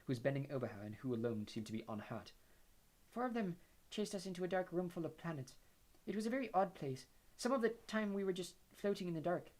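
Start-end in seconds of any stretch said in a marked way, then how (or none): 3.1-9.5 high, airy dreamy voice; dreamy Luna. Acted. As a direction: drift through it dreamily. Luna recounts.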